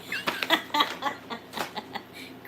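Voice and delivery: long breathy run of chuckles; laughing. Real, not acted. Seller amused at her own rating, laughing. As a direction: laugh it out in a string of light bursts.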